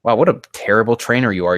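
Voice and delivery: ordinary talk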